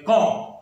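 کام with no L sound, long vowel straight into M; silent L. Shown as a demonstration. Teacher shows how to read it, silent L in کام. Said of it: "ایل silent ہونے کی وجہ سے".

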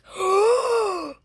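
loud high staged gasp; mock shocked gasp. Acting shocked for the gift giver, staged gasp. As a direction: gasp big to show shock. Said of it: "really show her I'm, like, shocked".

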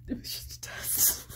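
hushed unvoiced whisper. Whispering into the phone to her boyfriend. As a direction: breathe it out in a whisper.

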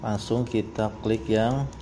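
ordinary talk, nothing marked

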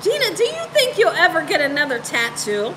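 usual speaking voice

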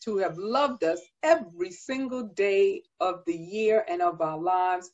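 ordinary talk

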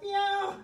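high-pitched playful meowing like a cat; high cat meows. Pretend. Fitness host to class doing cat stretch, high playful meows. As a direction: mew like a cat to cue the stretch. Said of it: "round that back like a cat".

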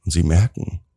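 usual speaking voice

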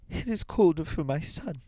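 put-on high-pitched grieving old woman; acted grief, pitched far up; weeping mother. A narrator's reading. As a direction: mourn in a high, tearful voice. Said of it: "and wept afresh".

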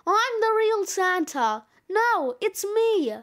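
insistent shouted bickering; squabbling shouts. Acted. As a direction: argue loudly, stretching the last word. Santas go on shouting rival claims.

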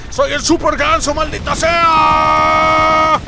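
high, loud battle cry, the last words stretched slow; a real, full-throated victory yell; triumphant shout. Real, not acted. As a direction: yell it out like a hero transforming.

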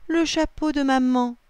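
high, loud cry of dismay. A narrator's reading. Lily watches mum's hat float away, dismayed cry. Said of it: "s'écrie-t-elle".

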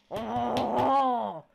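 loud, slow, high mock evil chuckle; slow hammed-up villain laugh. Streamer laughing like a villain at his pizza game. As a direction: draw out a cartoon villain laugh.

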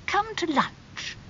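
very high, slow invitation; high, drawn-out society lady; inviting aristocratic aunt. A narrator's reading. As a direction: invite a nephew in a singing aunt's voice.

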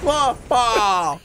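high, celebratory mock-Greek whoop; hammed-up Greek encounter. Greek relatives celebrating together, hammed-up accent. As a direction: cheer like a party Greek. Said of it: "exactly how every Greek encounter occurs".

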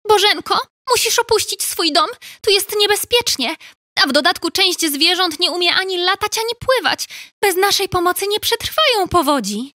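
high, chirpy urgent little bird; high sparrow pleading urgently. Acted. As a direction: warn her to leave, as a small bird. Sparrow Wojtek voice.

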